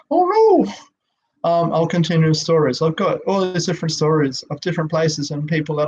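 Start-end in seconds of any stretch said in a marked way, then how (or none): 0.1-0.7 high squeaky oh no, a little louder; mock dismay. Real, not acted. Broadcaster fearing the tech fails, high mock dismay. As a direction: squeal it in comic alarm.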